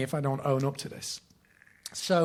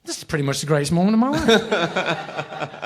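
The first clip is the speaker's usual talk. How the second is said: slow, laughing disbelief; giddy laughing; 3 semitones higher, 6 dB louder than usual. Retold or imitated. Fan recalling getting backstage, slow laughing delight. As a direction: savour it through a laugh, slowly. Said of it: "And I was like".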